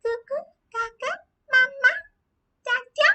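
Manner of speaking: put-on very high-pitched babbling baby; tiny squeaky infant voice; baby talk. Shown as a demonstration. Teacher showing how the baby otherwise talks, high baby babble. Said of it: "this baby otherwise talks like this".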